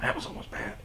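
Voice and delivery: breathless whispered "Oh, fuck"; whispered aside. Retold or imitated. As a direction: hush it like a private realization. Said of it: "you'd go".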